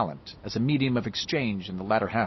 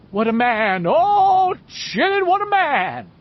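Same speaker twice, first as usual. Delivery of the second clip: much higher and louder hammed-up awe; mock awestruck; 8 dB louder, slower than usual. A narrator's reading. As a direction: salaam and gush in mock worship. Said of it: "in a trembling, awestruck voice".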